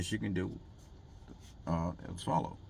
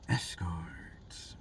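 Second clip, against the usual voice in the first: whisper; 3 semitones lower, 5 dB quieter than usual. Pretend. Vlogger whispering his answer to lonely viewers. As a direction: whisper the word escorts.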